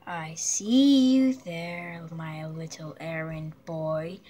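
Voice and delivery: low, slow taunting villain; menacing villain voice. Acted. Villain taunting the errand boy, low slow menace. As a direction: purr it like a cruel puppet master.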